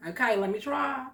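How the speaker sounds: ordinary talk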